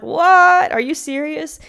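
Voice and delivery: high, loud startled what; shocked disbelief. Retold or imitated. Woman startled when tow driver yells, high and loud. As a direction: act out your stunned reaction.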